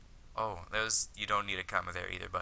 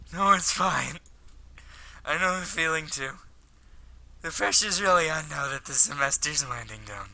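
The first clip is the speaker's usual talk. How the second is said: put-on higher-pitched, slightly louder boyish voice; understanding schoolboy; 8 semitones higher, 9 dB louder than usual. Hikaru reassures Akiko about exam pressure, higher boyish voice.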